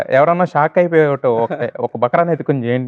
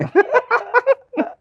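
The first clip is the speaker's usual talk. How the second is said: high laugh. Real, not acted. Burst of laughter after joke.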